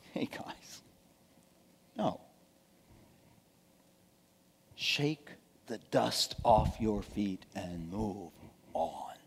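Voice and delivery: very soft, low rebuke; calm the hotheads in a hushed, low voice; quiet correction. Acted. Jesus gently answering angry disciples.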